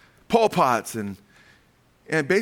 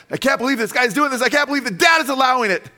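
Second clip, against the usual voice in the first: horrified disbelief; 10 semitones higher, 4 dB louder than usual. Retold or imitated. Onlooker's horrified inner voice.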